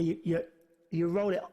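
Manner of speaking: usual speaking voice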